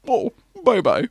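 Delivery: a light Connery voice, high, amused; Sean Connery impression. Connery brushing off a departing actor, light Scottish impression. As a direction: toss off a Scottish bye-bye. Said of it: "Sean Connery just goes".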